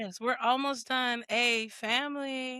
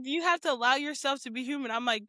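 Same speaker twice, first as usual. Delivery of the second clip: usual speaking voice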